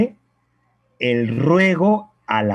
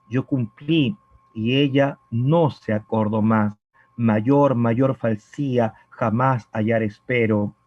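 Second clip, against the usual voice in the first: lower, measured reading of a sad love poem; poem recitation; 4 semitones lower than usual. Acted. Teacher reciting a yaraví to the student, lower and measured. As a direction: read the verses aloud as a lament to the class.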